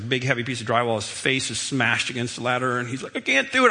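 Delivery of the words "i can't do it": put-on straining child. Son straining under heavy drywall.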